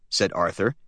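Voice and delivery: ordinary delivery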